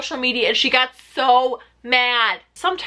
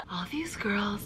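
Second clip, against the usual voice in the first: Crystal attempt; 5 semitones lower, 13 dB quieter than usual. Shown as a demonstration. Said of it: "how do you do a proper Crystal".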